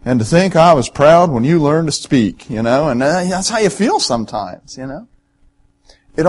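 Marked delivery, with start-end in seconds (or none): 0.0-2.5 imitated Southern accent; embarrassed Southern dad, lightly imitated; Andy Griffith Show's Andy. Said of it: "in that southern accent said".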